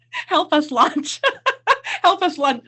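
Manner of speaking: laughing through the words; breaking up mid-sentence. Real, not acted.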